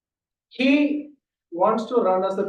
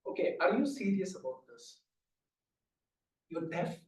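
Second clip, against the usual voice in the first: quiet; 3 semitones lower, 9 dB quieter than usual. Retold or imitated. Speaker testing friend quietly.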